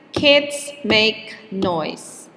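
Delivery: put-on slow, with kids, make and noise each hit hard; three even beats; stressed rhythm. Shown as a demonstration. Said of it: "the time between stressed words is always the same".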